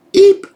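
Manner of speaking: very high, loud little "eep"; comic squeak. Retold or imitated. Reader mimes dismay at bad news, high loud squeak. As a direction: squeak in mock alarm.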